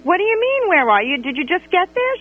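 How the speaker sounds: high pitched indignant questions; can't believe what you're hearing; incredulous disbelief. Retold or imitated. Incredulous at late friend.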